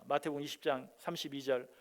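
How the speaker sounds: ordinary talk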